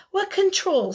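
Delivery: a lightly voiced Bear asking in wonder; character voice. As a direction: read it as a surprised Bear. Reading Bear's quote aloud.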